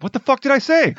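high-pitched mock indignation; hammed-up exasperated comeback; retold defiant retort. Acted retort in retold quarrel.

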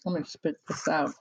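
talking with food in mouth; words round a mouthful; mouth full. Real, not acted. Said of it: "I'm chewing".

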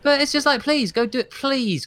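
exasperated. Real, not acted. Exasperated at backseat critics.

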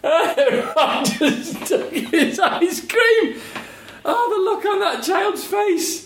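laughing through the words, high and slow; can barely get it out for laughing. Real, not acted. Laughing retelling of dog stealing.

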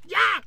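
short, very high bird screech; peacock squawk. Acted. Feather springing up as he finishes, high peacock screech. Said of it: "Like a peacock".

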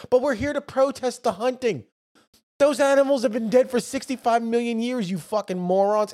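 mock protester. Mocking protesters and retort.

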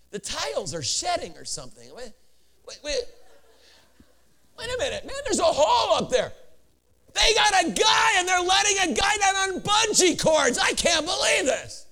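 starts hushed, builds to high, astonished shouting; growing disbelief. Acted. Churchgoer watching the roof open mid-sermon, puzzled then shouting in disbelief.

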